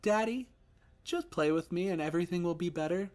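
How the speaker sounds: put-on high, pleading little boy; son's voice. Ghost son pleading with daddy, high and childlike. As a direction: coax him like a small child. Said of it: "sounding like my son did".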